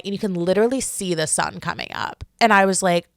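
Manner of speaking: normal talking voice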